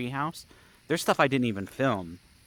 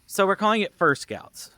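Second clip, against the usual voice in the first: higher, louder reveal; 9 semitones higher, 6 dB louder than usual. Real, not acted. Vlogger proudly announcing the renamed camp, higher and louder. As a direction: announce the new name brightly.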